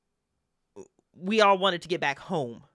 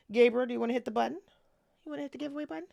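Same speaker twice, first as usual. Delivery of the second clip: coaxing the dog; 3 semitones higher, 4 dB quieter, much faster than usual. Real, not acted. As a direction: sweet-talk the dog into pressing the button. Coaxing the dog to press button.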